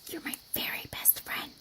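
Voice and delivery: soft unvoiced whisper. A narrator's reading. Girl whispering to her best friend in the corner, a soft secret whisper. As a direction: breathe it to your friend in secret. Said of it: "Priscilla whispered".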